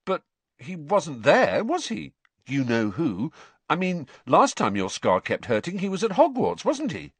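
stunned, searching questions; dumbstruck. Acted. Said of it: "Ron simply looked dumbstruck".

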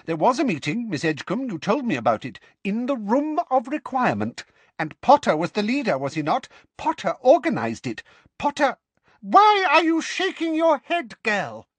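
building to a high, loud shriek; losing control, rising to a screech; furious Umbridge. Acted. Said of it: "said Umbridge furiously".

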